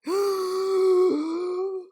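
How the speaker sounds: long, loud, exaggerated gasp; huge gasping inhale. A narrator's reading. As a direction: suck in a humongous breath. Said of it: "took a humongous breath".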